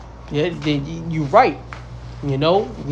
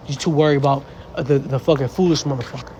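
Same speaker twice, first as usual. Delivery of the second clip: normal talking voice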